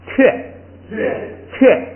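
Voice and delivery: 雀 said slowly, one syllable at a time, to be repeated; teacher leads the class reading 雀; model reading 雀. Shown as a demonstration. Teacher reading along with students, slow model. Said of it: "后边第三个啊是".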